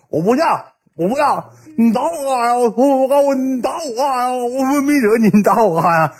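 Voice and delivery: high-pitched mock wail; scared pleading. Beaten friend up on a wall, pleading in mock fright. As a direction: whine it as a hammed-up victim.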